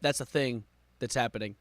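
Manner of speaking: ordinary talk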